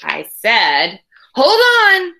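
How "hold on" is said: urgent call. Acted. Boy interrupts teacher.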